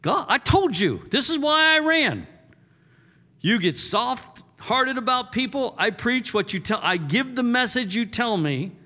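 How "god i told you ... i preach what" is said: Jonah's complaint. Acted. Jonah voiced complaining to God.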